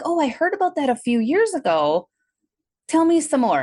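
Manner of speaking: high-pitched retold eagerness; eager curiosity. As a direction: re-enact keen interest, voice lifted high. Retold eager reply to new acquaintance.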